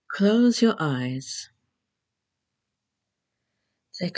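measured relaxation instructions with long pauses; guided meditation. Acted.

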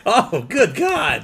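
high, slow cry of dismay; real high-pitched outburst; exasperated exclamation. Real, not acted. Reacting to the partner's remark.

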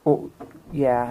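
ordinary talk

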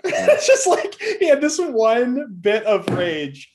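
high, loud, slow through a laugh; amused high slow retelling. Real, not acted. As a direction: laugh while describing his rage. Laughing at the rage moment.

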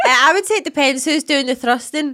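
laughing shout. Real, not acted. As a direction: blurt it through laughter. Laughing loudly.

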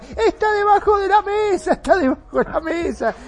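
high, loud panicked yell; terrified shout to the gunmen, twice. Retold or imitated. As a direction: scream it out to the robbers. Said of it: "empecé a gritar".

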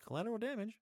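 high, put-on uncaring voice; callous mock voice. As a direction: sneer it like someone who doesn't care. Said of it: "can't hear kids dying and be like".

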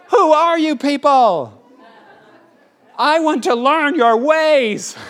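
high, loud, drawn-out wonder; mock amazement. Preacher meeting young people without social media, in mock awe. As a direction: stretch out the hammed-up astonishment.

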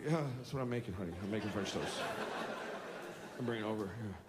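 very soft, low, subdued reply; hushed, weary parental patience; placating dad. Retold or imitated. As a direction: answer the tyrant meekly and gently.

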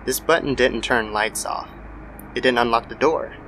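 ordinary delivery

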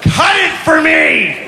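high, slow, drawn-out demand; angry toddler. Acted. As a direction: demand it as a furious three-year-old. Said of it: "she's a little ball of anger".

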